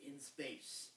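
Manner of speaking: hushed, slow movie-trailer tagline; low, slow horror tagline read. Pretend. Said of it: "I heard that at the movies once".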